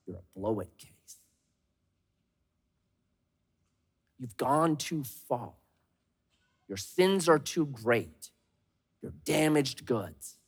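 low, soft, slow whisper of lies; the enemy whispering condemnation; accusing whisper. Acted. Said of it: "whispering to us".

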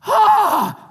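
loud high mock "ah" of disgust; a hammed-up recoil; disgusted exclamation. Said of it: "She just goes".

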